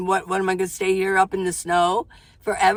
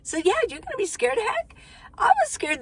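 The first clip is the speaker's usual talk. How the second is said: high quick aside; 12 semitones higher than usual. Real, not acted. Reassuring with a high rush.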